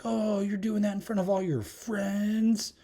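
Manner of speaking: high, slow, taunting big-sister voice; high drawn-out mock sister. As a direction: tease him like a smug older sister. Mocking older sister voiced.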